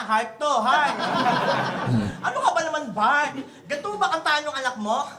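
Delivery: ordinary delivery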